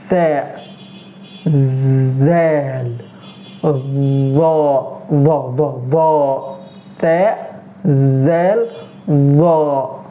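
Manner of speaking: Tha, zal, dha with tongue between teeth; tongue-tip letters. Shown as a demonstration. Teacher showing three tongue-and-teeth letters. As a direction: push air over the tongue for Tha and dha. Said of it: "When we pronounce them ... tongue with the teeth".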